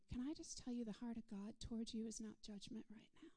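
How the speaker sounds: ordinary talk, nothing marked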